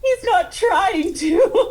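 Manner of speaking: high, loud, laughing denial; high and loud through laughter; laughing protest. Real, not acted. Laughing protest he's not mocking.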